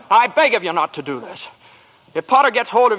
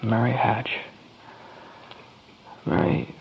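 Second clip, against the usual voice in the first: much lower, much softer, brooding; troubled murmur; 10 semitones lower, 8 dB quieter than usual. Acted. George murmurs to himself, troubled after Potter's offer. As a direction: turn inward, nearly under breath.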